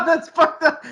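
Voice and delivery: high, loud laugh through the words; cracking up while talking. Real, not acted. Co-host reacting to a dark joke, laughing hard.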